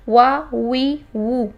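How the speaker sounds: وا وی وو with rounded lips and a clear w; drill demo. Shown as a demonstration. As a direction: model the syllables for repetition. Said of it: "اب اس کی مشق کرتے ہیں".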